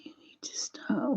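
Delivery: soft breathy whisper of a painting tip. Real, not acted. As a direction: whisper it like you don't want to disturb the brush. Quiet painting tip to viewers.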